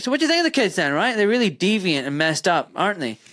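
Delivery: high-pitched mock-innocent question. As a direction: put it to mom with playful needling. Retold needling question to mother.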